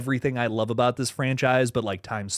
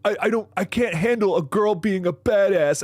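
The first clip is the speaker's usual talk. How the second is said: mocking whiny complainer; 6 semitones higher than usual. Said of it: "a lot of people are like".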